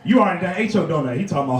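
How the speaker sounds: ordinary talk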